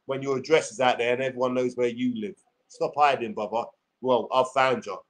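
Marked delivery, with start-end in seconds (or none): none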